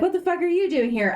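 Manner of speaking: high, rapid, incredulous blurt; startled confrontation. Retold or imitated. Paige demands answers from her other self, startled.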